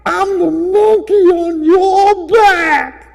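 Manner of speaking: shrill high teasing squeals; high puppet taunting. Acted.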